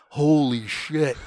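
slow, loud mock disbelief; stunned recruit. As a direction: stretch each word in retold shock. Retold recruit's shock at the scene.

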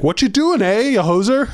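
high-pitched mock Canadian outburst; hammed-up Canadian accent, playfully angry. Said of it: "slip into his native Canadian accent".

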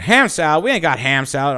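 high-pitched backwoods server; mock indignant Southern waitress; incredulous diner waitress. Acted waitress refusing order.

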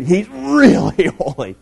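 REALLY stretched out long, amused; exaggerated emphasis. Shown as a demonstration. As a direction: lean hard and slowly on really. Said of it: "To emphasize it".

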